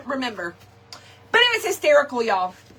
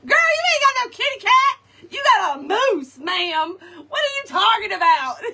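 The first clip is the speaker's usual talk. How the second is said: high, loud and laughing through it; loud high laughing retort; laughing retold comeback; 10 semitones higher, 4 dB louder than usual. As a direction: crack up while delivering the punchline. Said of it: "I made a moose noise".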